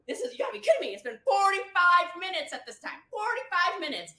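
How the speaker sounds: high, loud incredulous emphasis; exasperated outburst. Real, not acted. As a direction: hammer the time home in disbelief. Exasperated at how early it is.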